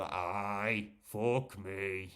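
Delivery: a broad Geordie "aye, fuck me"; Geordie accent. Shown as a demonstration. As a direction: show off his own Geordie accent. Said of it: "what kind of accent do you have?".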